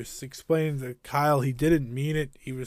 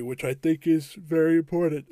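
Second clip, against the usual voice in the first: yawning. Real, not acted. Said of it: "Oh, excuse me with the big yawn of the day".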